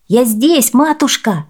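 put-on high, loud boyish call; excited young boy's shout. A narrator's reading. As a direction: announce yourself eagerly to mother. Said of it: "закричал Хуанита".

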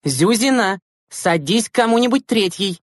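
teacher's shout. A narrator's reading. Teacher ordering a latecomer to sit, calling out. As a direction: call the order across the bus. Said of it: "крикнула учительница".

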